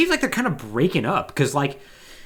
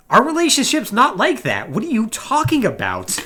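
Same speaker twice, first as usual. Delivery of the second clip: mock indignant denial; 9 semitones higher, 3 dB louder than usual. Character denying relationship.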